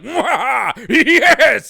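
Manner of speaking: high, loud evil mwahaha laugh; villain cackle. Retold or imitated. Mocking the villains with a loud cackle. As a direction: cackle like a comic book villain. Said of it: "these guys are so like".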